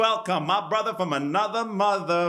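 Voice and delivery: high, drawn-out show-host introduction, building to a held name; announcer's guest introduction. Real, not acted. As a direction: stretch the name out like a ring announcer. Host announces guest to cheering audience.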